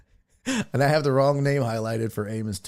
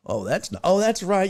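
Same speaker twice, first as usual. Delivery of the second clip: ordinary delivery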